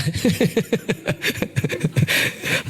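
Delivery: long chuckling run of laughs; laughter. Real, not acted. Preacher asks congregation if they have changed, chuckling. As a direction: laugh lightly through a long burst.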